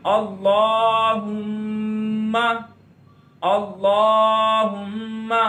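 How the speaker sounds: अल्लाहुम्मा slowly, long aa and long hummed mm; ghunna on meem. Shown as a demonstration. Quran teacher shows the ghunna on meem, slow nasal hum. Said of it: "गुन्ना करेंगे".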